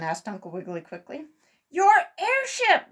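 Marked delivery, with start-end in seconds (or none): 1.7-2.9 high, loud and slow; alarmed exclamation. A narrator's reading. Sammy telling Uncle Wiggily the airship is gone, alarmed. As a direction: cry it out in dismay. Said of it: "exclaimed Sammy".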